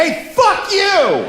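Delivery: high, slow yelled curse; mock shout at a pickup truck driver; mock road rage yell. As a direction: yell it at the window, hammed-up. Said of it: "I yelled out my window".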